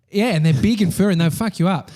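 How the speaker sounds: ordinary delivery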